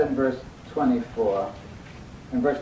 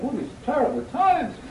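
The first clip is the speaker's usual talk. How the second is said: high-pitched, loud mock lament; put-on bewildered griping; mock moping; 12 semitones higher, 5 dB louder than usual. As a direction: wail like a hammed-up complainer. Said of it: "moping around saying".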